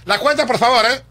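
loud, high call for the bill; a retold yell for the check; mock shout. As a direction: bark the order across the room. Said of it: "Gritó".

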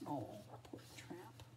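soft, slow whisper; hushed, sorry aside; pitying aside. Real, not acted. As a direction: murmur it with sympathy. Reader pities Tramp in an aside.

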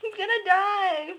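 loud, high nervous laughing; slow laughing words; panicked laughter. Real, not acted. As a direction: laugh helplessly while the fish flops.

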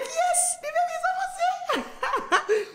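high, soft squealing quote; thrilled squeal. Retold or imitated. Reliving the video call with her sister, a high thrilled squeal. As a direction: squeal it like you can't believe it. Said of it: "I'm like".

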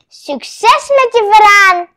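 lightly acted seller calling after a customer; terrarium seller's send-off. A narrator's reading. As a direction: call out a loud, high-pitched good-luck wish. Seller's cheery send-off, quoted.

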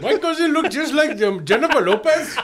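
put-on loud, very high Apu-style Indian accent; mocking Indian accent. Host parrots cohost's cousin joke back, exaggerated Indian accent. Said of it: "you sound like Hank Azaria when he was doing Apu".

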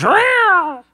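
loud, high imitated meow; cat's meow. Big cat jumps out at mice, loud imitated meow. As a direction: yowl like a menacing cat. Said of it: "A big cat jumps out and goes".

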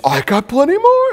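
high, bright, playful character voice; cheerful high voice. Retold or imitated. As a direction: pitch it way up, eager to please. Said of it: "and then he's".

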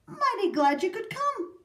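high, loud, grand greeting; hearty welcome. A narrator's reading. Grandfather voicing the president's welcome, grand and hearty. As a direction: welcome them with booming delight.

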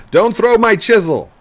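loud, high-pitched shout; mock yell. Player hamming up a scolding of his son, loud high yell. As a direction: holler it in hammed-up outrage.

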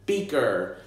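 ordinary talk

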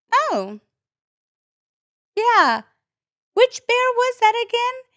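sing-song mock innocence; feigned innocence. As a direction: play dumb, all sweetness.

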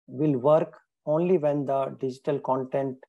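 ordinary delivery

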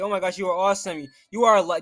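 ordinary talk

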